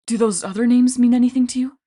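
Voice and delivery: lightly voiced young man; Frank's voice. A narrator's reading. Frank asks Hazel.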